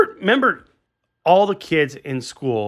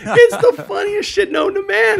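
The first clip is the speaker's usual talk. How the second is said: laughing; 5 dB louder than usual. Real, not acted. Laughing at the [name] story.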